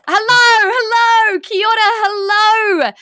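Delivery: high, loud, bright-eyed hello; excited greeting. Real, not acted. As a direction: sing out the welcome to the listeners. Host opens the podcast episode.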